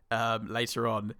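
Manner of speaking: ordinary talk, nothing marked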